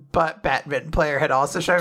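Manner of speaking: usual speaking voice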